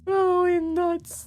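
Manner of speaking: very high, slow, stretched squeal; squealing with laughter. Real, not acted. Streamer cracking up at holy nuts, drawn-out squeal. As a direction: wheeze it out high before collapsing laughing.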